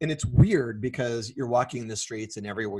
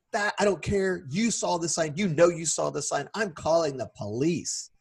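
higher-pitched mock indignant rant; retold angry yell; 6 semitones higher than usual. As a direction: imitate a worked-up stranger scolding. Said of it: "he starts to yell at me".

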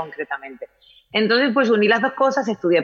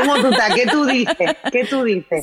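higher, laughing Dominican phrases; laughing Dominican accent; 6 semitones higher than usual. Retold or imitated. Guest laughing as she repeats Dominican phrases: Dominican accent. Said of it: "él me ha dominicanizado".

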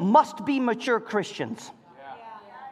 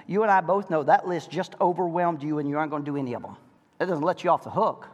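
ordinary talk, nothing marked

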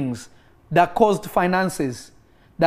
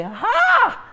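surprised gasp. Retold or imitated. Acting out amazed reaction.

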